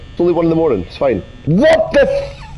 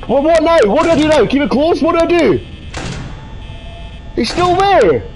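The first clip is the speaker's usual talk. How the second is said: panicked; 6 semitones higher than usual. Real, not acted. Panicking in horror game.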